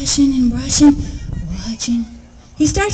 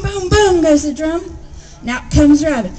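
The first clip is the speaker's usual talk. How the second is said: usual speaking voice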